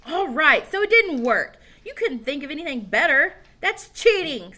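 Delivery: put-on high, loud heated boy; angry arguing. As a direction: argue it hotly. Heated argument.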